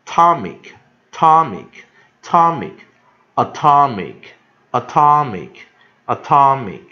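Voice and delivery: "atomic" loud and high on TOM, falling after; pronunciation drill. Shown as a demonstration. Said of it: "都是念eek".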